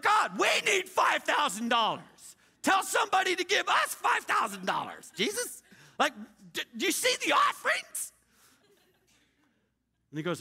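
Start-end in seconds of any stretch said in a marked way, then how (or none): 0.0-8.1 shouted, pitch climbing higher and higher; begging God in a rising shout; desperate plea to God. Retold or imitated. Pastor yelling at God about offerings, frantic shout.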